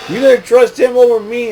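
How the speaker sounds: high-pitched mock whine of betrayal; aggrieved protest. Wrestler interrupting his friend over trust, high aggrieved protest.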